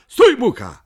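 high, loud shouted command; called out. A narrator's reading. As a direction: shout to halt someone, urgently. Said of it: "zawołał Migotek". (głośne, wysokie wołanie)

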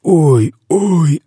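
high, wailing cry of a tormented giant; roaring wail. A narrator's reading. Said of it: "ревів тілогриз". (високий болісний рев)

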